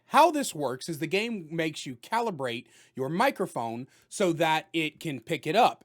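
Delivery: ordinary delivery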